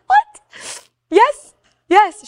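high, loud retold amazement; astonished. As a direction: blurt it high in disbelief. Retold astonishment at friend's question.

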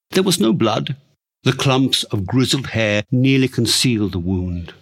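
ordinary delivery

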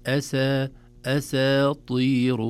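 أساطير slowly, the seen kept light before the heavy taa. Shown as a demonstration. Sheikh teaches students how to say أساطير. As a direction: keep the seen thin in أساطير. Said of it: "فنقول".

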